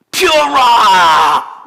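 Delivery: long, loud drawn-out battle cry, falling away; mock summoning shout. Preacher imagines himself on the cross calling down lightning, mock summoning shout. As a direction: bellow like a superhero unleashing a power.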